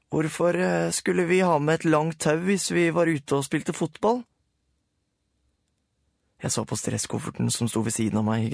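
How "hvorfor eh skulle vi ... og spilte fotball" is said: squeaky voice. A narrator's reading. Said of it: "ble pipet og tynn".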